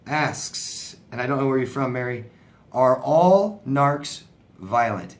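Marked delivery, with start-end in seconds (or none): 2.7-5.2 slow, leaning hard on "all"; stress on all. Shown as a demonstration. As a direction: read it slowly and hit "all" to question the word. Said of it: "And, and anytime I hear all".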